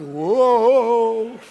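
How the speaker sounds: loud, high, drawn-out whoa; astonished reaction, re-enacted. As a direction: let out a big startled cry. Storyteller's startled reaction to shooting.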